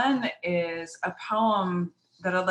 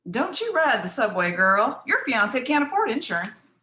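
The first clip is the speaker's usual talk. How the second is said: raised, higher-pitched parental nagging; scolding parent; 6 semitones higher, 4 dB louder than usual. Acted. Parent to engaged girl about the subway, scolding.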